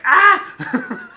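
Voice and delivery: high laughing shriek; mock shriek. Imitating his partner's frustrated cry, a laughing shriek.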